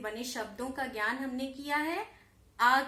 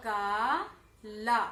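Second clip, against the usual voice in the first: slow drawn-out demo; 4 dB louder, much slower than usual. Shown as a demonstration. Teacher to pupils, stretching the new word slowly. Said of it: "तो क्या बनेगा?".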